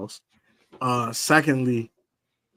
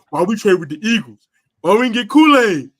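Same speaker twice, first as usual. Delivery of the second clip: louder, outraged fan complaining about the trade; a hint of an angry fan's rant; mad fan voice; 5 semitones higher, 8 dB louder than usual. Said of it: "the mad fan voice".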